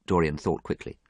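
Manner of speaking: usual speaking voice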